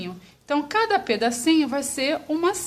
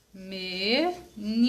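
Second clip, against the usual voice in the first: "Menina" stretched slowly as me, ni, na; syllable by syllable; 3 semitones lower, 4 dB quieter, much slower than usual. Shown as a demonstration. Teacher introducing how menina separates, syllable by syllable. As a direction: draw out "Menina" in three slow pieces. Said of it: "Vamos falar devagar".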